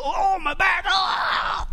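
high shouted mock groan of an aching back; hammed-up old-body back pain; pained cry. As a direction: yelp like your back just went out.

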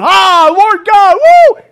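loud, high, slow cry ending in a whoop; shouted, ecstatic praise; erupting praise. Acted. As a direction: burst out like the whole room erupts. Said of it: "should have erupted going".

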